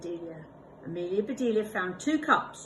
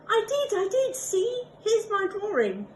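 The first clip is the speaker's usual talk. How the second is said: eager protest; 14 semitones higher, 6 dB louder than usual. Acted. Proudly answering Missus Rogers.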